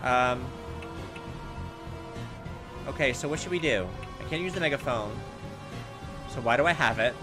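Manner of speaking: ordinary talk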